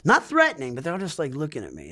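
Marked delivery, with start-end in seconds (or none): none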